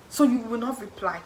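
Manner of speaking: ordinary talk, nothing marked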